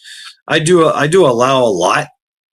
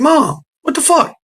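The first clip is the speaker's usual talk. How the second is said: retold exclaim. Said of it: "I was like".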